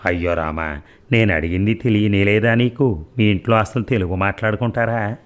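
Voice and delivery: ordinary delivery, nothing marked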